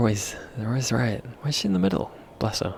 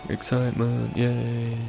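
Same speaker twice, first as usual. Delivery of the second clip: deadpan fake cheer; 8 dB quieter than usual. Flat sarcastic cheer continues.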